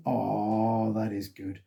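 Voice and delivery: ordinary talk, nothing marked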